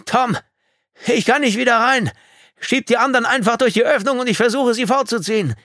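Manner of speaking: high, full-force shout; strained, desperate holler; shouting against wind. A narrator's reading. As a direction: yell up to him over the gale. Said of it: "Er schrie gegen den Wind an".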